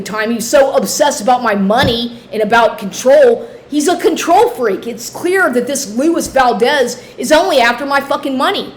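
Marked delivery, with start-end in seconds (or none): none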